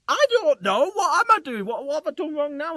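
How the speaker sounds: high, loud panicked blurting; panicked yelp. Retold or imitated. Flustered driver yelling back at the border guard in panic. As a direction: squeal it out like you're caught red-handed. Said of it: "I was like".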